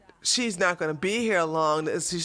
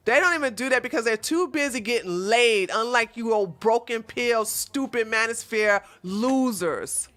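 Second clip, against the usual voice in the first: ordinary talk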